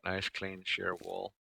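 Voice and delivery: Nice clean sheer wall murmured slow and soft; soft admiring. Real, not acted.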